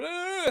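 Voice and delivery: high, loud wounded groan; distressed ugh. Retold or imitated. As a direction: mimic the performer's anguish. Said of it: "And they were".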